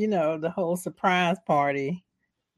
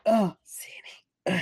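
breathy dying whisper of a name; faint whisper; slower than usual. Retold or imitated. Wounded Billy whispering to Sidney in the movie. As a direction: barely voice it, slowly.

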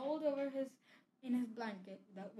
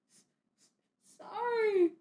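stammered sorry through sobs, higher and louder; sobbing; 10 semitones higher, 13 dB louder than usual. A narrator's reading. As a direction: choke out the apology crying. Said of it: "sobbed Hagrid".

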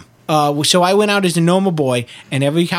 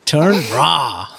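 mock foreign GPS; slower than usual. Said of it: "mimicking foreign GPS".

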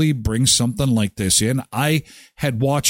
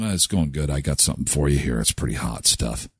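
lower, sly dealer voice, lightly done; a shifty thief offering hot goods; low shady seller voice; 13 semitones lower, 5 dB quieter, faster than usual. Pretend. Acted thief selling stolen goods.

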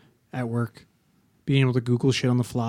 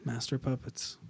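quiet; 12 dB quieter than usual. Real, not acted. Quiet repeat of own line.

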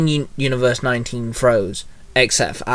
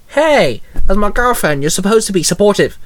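high, indignant retort; mildly snappy protest, starting high; snapped; 6 semitones higher, 3 dB louder than usual. A narrator's reading. Boyfriend to girlfriend about being supportive, snapped. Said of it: "Justin snapped".

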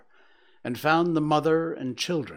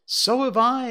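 much higher and louder, eager; excited exclamation; 12 semitones higher, 8 dB louder than usual. Acted. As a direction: chime in eagerly, a young voice rising. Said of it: "exclaimed another".

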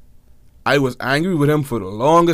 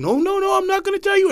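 much higher, teasing refusal; sister's voice. Retold or imitated. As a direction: tease the brother by refusing. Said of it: "my brother and my sister".

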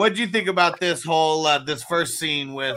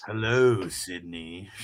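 deep killer voice; 9 semitones lower, slower than usual. Retold or imitated. Killer voice quoted from movie.

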